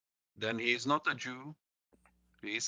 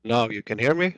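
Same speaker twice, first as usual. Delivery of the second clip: much louder, calling down a bad line; to someone hard of hearing; 11 dB louder than usual. Real, not acted. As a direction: raise your voice so they hear. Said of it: "speak louder".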